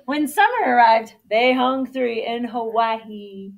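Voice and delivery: loud, lively storybook narration; reading to children. A narrator's reading. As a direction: read it out big and animated for kindergartners. Reads story to kindergarten.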